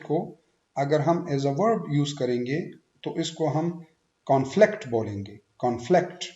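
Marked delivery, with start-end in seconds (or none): 4.2-4.9 conflict stressed on फ्लिक्ट; light कॉन, weight on फ्लिक्ट in conflict; second-syllable stress. Shown as a demonstration. Teacher shows verb conflict, second-syllable stress. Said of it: "second syllable पे stress". 5.6-6.2 conflict rising on फ्लिक्ट; second-syllable stress. Shown as a demonstration. Teacher repeats verb conflict, second-syllable stress. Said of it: "second syllable पे stress".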